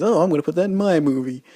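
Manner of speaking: a hint of a pleased filmmaker grabbing an idea; copycat director. Reviewer mocking a copycat director, lightly voiced quick mock. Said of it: "and says".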